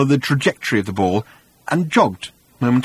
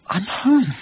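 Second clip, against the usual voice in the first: high, dreamy sigh of contentment; blissful daze; 10 semitones higher than usual. Acted. Arthur not listening to Ford, blissful daze. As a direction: drift off happily, pitched high.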